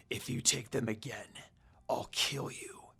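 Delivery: low, hushed growling threat. A narrator's reading. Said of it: "in a growling voice".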